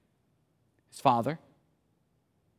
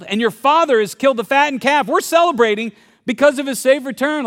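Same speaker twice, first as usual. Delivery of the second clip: higher, louder eager announcement; servant's news; 8 semitones higher, 6 dB louder than usual. Retold or imitated. Servant telling the older brother his brother is back, eager. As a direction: tell the news with excitement. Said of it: "he was told".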